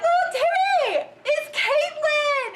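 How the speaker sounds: excited high shout from a car window; calling out. Retold or imitated. Dad shouting to his son from the car, excited high shout.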